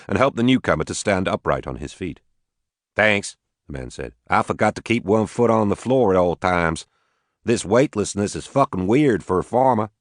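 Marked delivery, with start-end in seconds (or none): 3.0-3.4 lightly voiced Southern farmer; a hint of a Southern drawl; southern accent. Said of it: "He had a heavy southern accent". 4.3-9.9 lightly voiced Southern farmer; southern accent. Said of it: "He had a heavy southern accent".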